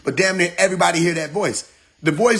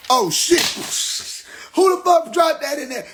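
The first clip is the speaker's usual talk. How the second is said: higher mock shock; mock surprise; 11 semitones higher than usual. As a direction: caught off guard, hammed up. Acting out picking it up.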